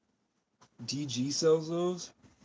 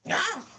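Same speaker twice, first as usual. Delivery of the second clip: sudden high squawk; animal screech; 3 dB louder than usual. Pretend. Pretending to be an animal, a sudden high screech. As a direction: shriek out like a wild bird. Animal noise bit outdoors.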